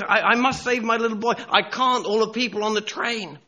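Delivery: high, loud agonised dilemma; panicked father. Acted. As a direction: act a desperate dad thinking aloud. Father torn between son and passengers.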